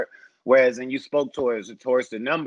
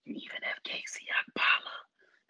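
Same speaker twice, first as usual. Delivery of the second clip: voiceless stage whisper; hushed, confidential aside in a whisper; mock-secret whisper; 10 dB quieter than usual. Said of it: "I'm gonna whisper this".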